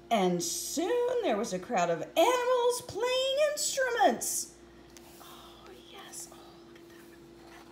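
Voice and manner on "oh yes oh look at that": hushed, breathy whisper. Real, not acted. Reader whispers to listeners over a picture, hushed. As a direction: lean in and whisper it.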